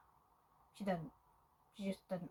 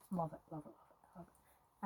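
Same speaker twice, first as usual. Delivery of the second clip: repeated love it trailing off into a whisper; fading to a whisper; 2 semitones lower, 9 dB quieter than usual. Real, not acted. Fan gushing about the film, fading to a whisper. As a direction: let the repeats drain away until only breath is left.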